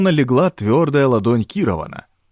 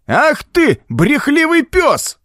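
put-on very high, drawn-out old man's rage; angry flare-up; much slower than usual. A narrator's reading. Old Baines flares up at Gordon, high and furious. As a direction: explode in a high, slow insult. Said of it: "опять вспылил Бэйнс".

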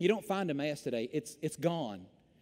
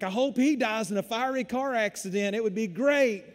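sarcastic fake sweetness, a bit louder; mock spite; 4 semitones higher, 5 dB louder than usual. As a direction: ham up the venomous wish. Acted spiteful Facebook post.

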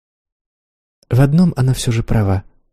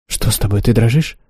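ordinary delivery

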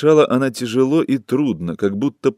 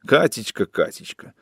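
mocking mimicry. A narrator's reading. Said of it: "а сам".

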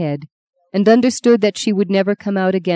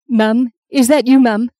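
higher, louder anxious call for mum; distressed; 6 semitones higher, 5 dB louder than usual. Acted. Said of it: "sensing Justine's distress".

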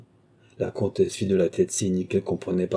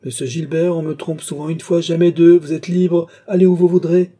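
high voice rising to loud outbursts; angry dismissal; 10 semitones higher, 9 dB louder than usual. A narrator's reading. Rousseau casting Gilbert out as a traitor, angry. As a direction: send him off in indignation, getting louder.

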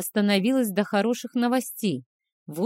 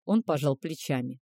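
plain reading voice

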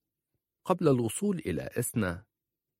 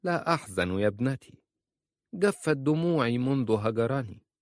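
firm and decisive, a touch higher at the end; 5 semitones higher than usual. A narrator's reading. Aunt answering the grieving girl, firm and decisive. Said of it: "قالت في حسم".

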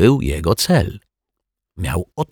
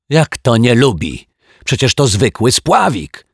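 loud, high outraged shout; indignant yell; 7 semitones higher, 7 dB louder than usual. A narrator's reading. Mr Mietek protests about the float, yelling. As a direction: yell it in disbelief. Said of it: "wrzasnął pan Mietek".

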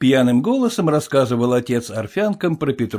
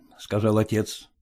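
usual speaking voice

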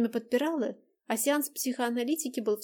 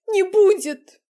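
loud high tearful cry; shout; 10 semitones higher, 13 dB louder, slower than usual. Acted. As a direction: yell it out in despair.

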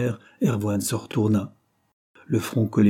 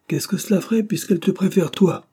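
high-pitched, resentful retort; scornful; 9 semitones higher than usual. A narrator's reading. Jealous brother retorting to his twin, scornful and high. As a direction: throw it back with contempt on toi. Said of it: "Que de mépris tu mets à prononcer ce toi".

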